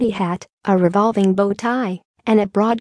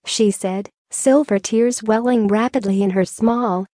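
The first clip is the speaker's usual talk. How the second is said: ordinary delivery, nothing marked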